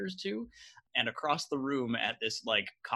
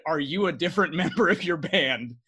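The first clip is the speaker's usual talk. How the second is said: laughing retelling; 5 semitones higher, 7 dB louder than usual. Retold or imitated.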